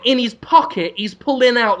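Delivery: ordinary talk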